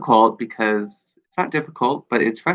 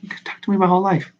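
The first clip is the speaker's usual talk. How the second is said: higher, fast, exasperated mock retort; 9 semitones higher, much faster than usual. Frustrated she tells those who won't talk to her, exasperated. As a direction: toss it off quick and high, fed up.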